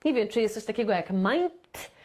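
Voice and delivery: ordinary talk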